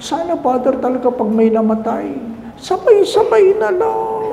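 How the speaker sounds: lightly mocking grieving relative; high mourner voice.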